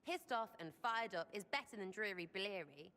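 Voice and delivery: ordinary delivery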